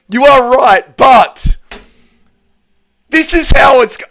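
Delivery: high, loud, tearful insistence; upset, near tears. Real, not acted. Streamer arguing with chat while crying, upset. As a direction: plead it through tears. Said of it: "It makes me sad".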